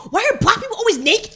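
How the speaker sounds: imitated wail of appalled kids, high and loud; mock distressed outcry; kids screaming. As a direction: cry out like a shocked schoolkid. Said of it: "if you hear kids screaming".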